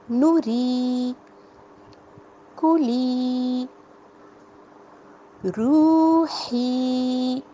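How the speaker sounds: نوری, کولی and روحی with their long vowels held slowly; drawn out vowels. Shown as a demonstration. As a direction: lengthen each long vowel fully. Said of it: "اب آپ دیکھیں،".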